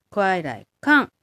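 快来看 slowly, with 看 falling sharply; Mandarin kuài lái kàn. Shown as a demonstration. Teacher drilling 快来看 for learners, slow Mandarin. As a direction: model 快来看 syllable by syllable, 看 dropping. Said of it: "見るにすると快来看".

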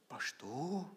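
high, soft disbelieving query; surprised question. Retold or imitated. Hearing his old pastor on the phone, softly surprised. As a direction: mouth the name in disbelief.

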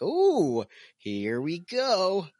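excited, drawn out. Retold or imitated. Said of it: "I'm like".

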